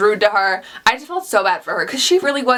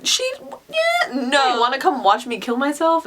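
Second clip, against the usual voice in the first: retold disbelief; 5 semitones higher than usual. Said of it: "I was like".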